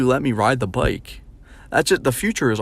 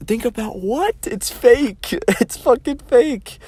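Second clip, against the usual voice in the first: much higher, louder, laughing disbelief; exasperated laughing rant; 13 semitones higher, 3 dB louder, slower than usual. Real, not acted. Venting about future advice, high laughing outrage. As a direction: crack up in outrage at the idea.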